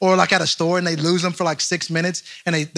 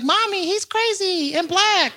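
put-on high, slow child's voice; little kid; 13 semitones higher, slower than usual. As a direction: imitate a small child telling on someone. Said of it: "and her kid's there".

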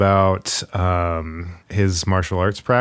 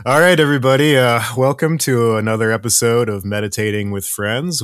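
announcer voice; 3 semitones higher, 4 dB louder than usual. Real, not acted. Podcast host intro, hyped.

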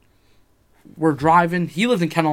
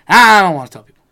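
loud, high mock whine; hammed-up loud refusal; protesting refusal; 5 semitones higher, 11 dB louder than usual. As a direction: blurt it out in exaggerated reluctance. Mimics own refusal to tell people.

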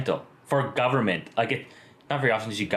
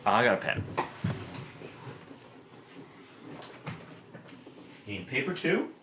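fading out; speaking from across the room; away from the mic; 3 semitones higher, 6 dB quieter than usual. Real, not acted. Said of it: "We're just walking away from the mic".

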